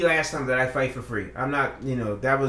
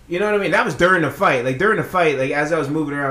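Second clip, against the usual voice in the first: ordinary talk, nothing marked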